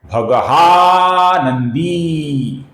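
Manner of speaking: भगहा नंदी said very slowly, with a clear break after भगहा; careful split pronunciation. Shown as a demonstration. Teacher showing how to avoid आनंदी, very slow. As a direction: draw out भगहा, then say नंदी separately. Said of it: "इस प्रकार उच्चारण कीजिए".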